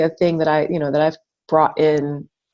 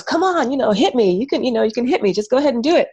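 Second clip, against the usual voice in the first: higher, eager egging-on; eager coaxing; 6 semitones higher, much faster than usual. Retold or imitated. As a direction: egg her on, playful and bright. Coaxing girl into pillow fight.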